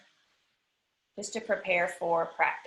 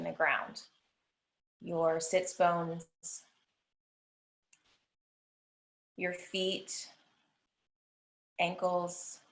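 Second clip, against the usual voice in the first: even, unhurried body-scan voice, long pauses; guided meditation. Acted. Yoga teacher guiding the class through a body scan, guided meditation. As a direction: lead them through the body phrase by phrase, leaving space to breathe.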